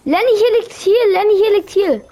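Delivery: high, urgent game callout; calling out. Real, not acted. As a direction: call it over urgently. Calling teammate to healing item.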